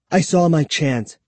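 plain reading voice

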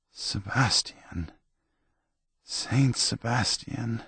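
soft, slow plea; muttering. A narrator's reading. Tomas before he slept, muttering softly to his saint. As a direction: murmur a drowsy prayer for help. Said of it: "not before he muttered".

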